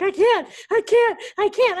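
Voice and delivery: high, pleading mock crying; acting out an overwhelmed, crying mother; imitated tearful refusal. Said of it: "she just starts crying".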